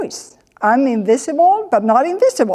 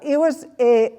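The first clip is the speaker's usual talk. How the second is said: ordinary talk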